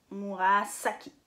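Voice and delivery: Murasaki with a long, hissed S in "saki"; S non voisé. Shown as a demonstration. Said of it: "vous prononcez S". (Murasaki avec un « s » sifflant, bien appuyé)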